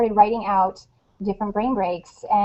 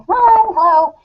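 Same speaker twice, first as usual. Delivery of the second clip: put-on calling kid back; 8 semitones higher, 5 dB louder than usual. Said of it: "snapping my fingers".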